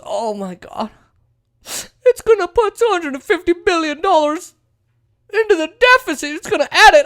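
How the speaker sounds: very high squealing alarm; mock panic. Opponents fret over the deficit, mock panic. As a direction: shriek the figures in hammed-up horror. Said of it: "their argument for why they voted against it was".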